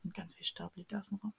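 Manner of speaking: whispered, dramatic and soft; spooky whisper. Acted. Mock-dramatic whisper about the hard podcast life. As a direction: whisper it like a desert tale.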